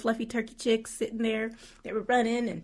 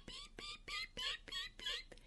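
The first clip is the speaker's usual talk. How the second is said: tiny high whispered beep beep beep; 16 dB quieter, slower than usual. Retold or imitated. Teacher mimics the beeping while taking out trash, high whispered beeps. Imitating truck backing up.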